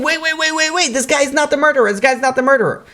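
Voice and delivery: high-pitched mock scream without much volume; frantic, strained high pleading; retold screaming. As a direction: cry out to halt an execution. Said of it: "he starts screaming".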